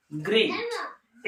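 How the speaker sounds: ग्रेट said very slowly, the final t crisp; drawn-out demo word. Shown as a demonstration. Teacher models ग्रेट slowly for students to repeat. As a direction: stretch out ग्रेट for the class. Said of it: "बोलिए एक बार".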